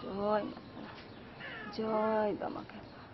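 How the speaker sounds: barely voiced, whispered into prayer; hushed prayer. Wife echoes prayer very softly. (ফিসফিস করে ভক্তিভরে প্রার্থনা)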